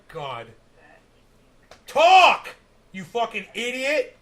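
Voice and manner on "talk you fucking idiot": furious yell, much higher and louder; angry shout. Acted. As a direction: scream at her to speak up. Yelling at a mumbling streamer.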